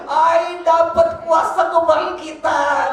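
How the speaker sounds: loud, high, fervent preaching; real shouted declaration of faith; triumphant proclamation. Real, not acted. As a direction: proclaim it at full voice with drawn-out emphasis. Proclaims his faith at full force.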